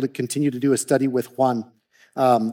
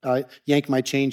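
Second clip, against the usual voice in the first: ordinary delivery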